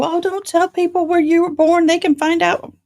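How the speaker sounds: high fussy warning, mocked; mocking worried people. Host mocking viewers who warn her about privacy, high and fussy. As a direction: mimic a fretful know-it-all. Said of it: "some people go".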